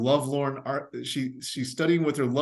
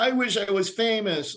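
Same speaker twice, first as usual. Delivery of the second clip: wishful victim; 8 semitones higher than usual. Retold or imitated. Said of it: "they go".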